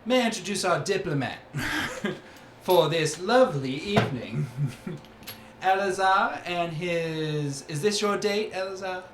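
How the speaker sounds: bright, teasing introduction with laughter; teasing Calliope. Acted. Calliope teasing Eleazar about his date, laughing. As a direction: tease the guest as you present him. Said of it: "Calliope looks over and she goes".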